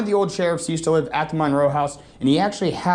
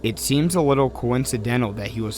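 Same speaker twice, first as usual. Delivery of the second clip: measured, even voiceover read; documentary narration; 4 semitones lower than usual. A narrator's reading.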